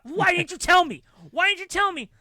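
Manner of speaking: high, loud accusing question; wife accusing husband in argument; angry demand. Retold or imitated. Parents quarrel in park.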